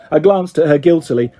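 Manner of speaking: ordinary delivery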